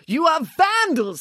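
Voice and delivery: high, slow indignant director; pompous, high-pitched fury; outraged accusation. Acted. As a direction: accuse them with drawn-out outrage.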